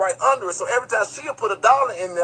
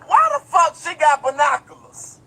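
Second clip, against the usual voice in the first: incredulous outburst; 8 semitones higher, 4 dB louder than usual. Retold or imitated. Outraged inner exclamation in courtroom.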